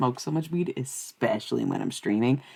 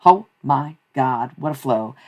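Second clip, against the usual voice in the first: usual speaking voice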